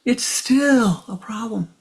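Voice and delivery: high, rising, pleading voice to the guru; distraught disciple begging for help; emotional plea. Retold or imitated. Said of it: "as I'm highly emotional".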